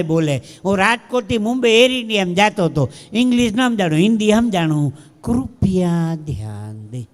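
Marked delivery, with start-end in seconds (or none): 5.2-7.1 low, slow, drawn-out Hindi announcement; sugary-sweet announcer. Acted. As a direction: croon it like a syrupy cabin announcement. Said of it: "એવું મીઠું મીઠું બોલ્યો".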